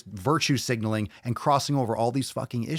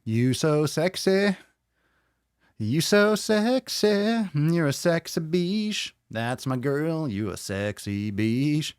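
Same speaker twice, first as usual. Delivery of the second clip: slow, high, drawn-out crooning; silly put-on flirting voice; mock seductive voice; 6 semitones higher, much slower than usual. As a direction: ham up the sweet talk, stretching every word. Hammed-up sweet talk, drawn out.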